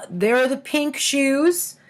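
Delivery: plain reading voice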